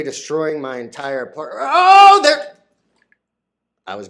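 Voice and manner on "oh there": sudden high loud oh; high squeal of shock; startled yelp. Real, not acted. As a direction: yelp in surprise as it drops on you. Turkey lands on his head.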